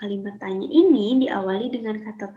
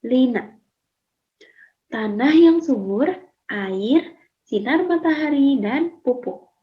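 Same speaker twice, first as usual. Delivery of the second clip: put-on louder, lively read-aloud of a two-girl dialogue for pupils; 2 semitones higher, 5 dB louder than usual. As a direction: perform the dialogue for the class so they can copy it. Teacher reads dialogue aloud. (membaca dialog dengan lantang dan ekspresif)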